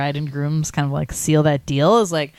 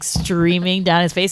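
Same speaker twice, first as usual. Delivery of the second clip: normal talking voice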